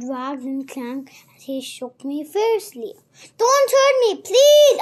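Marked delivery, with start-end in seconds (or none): 3.4-4.8 loud, high plea in terror; terrified begging. A narrator's reading. Frightened boy pleading with his attacker, terrified begging. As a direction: beg loudly for mercy, pitched way up. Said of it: "I begged in terror".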